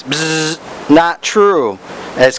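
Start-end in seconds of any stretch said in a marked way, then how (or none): 0.0-0.6 short imitated buzzer; wrong-answer buzzer noise. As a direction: buzz like a game-show wrong answer. Mimics buzzer rejecting Saul's claim.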